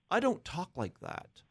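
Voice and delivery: high-pitched mock protest, falling at the end; imitated protesting suitor. Suitor refuses his hidden buddy's advice, high mock protest. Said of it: "he shakes his head and he goes".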